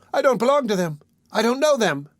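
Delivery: put-on high, panicked boy; frantic denial. A narrator's reading. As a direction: Oliver fights the man's powerful grasp, panicked and high. Oliver protests frantically.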